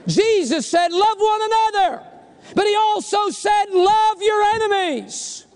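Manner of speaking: high-pitched, forceful preaching. Real, not acted. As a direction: ring it out to the congregation.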